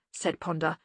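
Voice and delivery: plain reading voice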